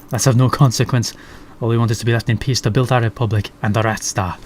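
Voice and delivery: lightly voiced Scottish sergeant. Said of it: "I don't know why she's Scottish".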